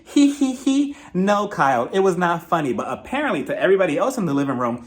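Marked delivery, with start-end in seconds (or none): 0.1-1.0 high, fake tittering; mock giggle. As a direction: imitate a silly, self-amused giggle. Mocks Kyle's giggle at own joke.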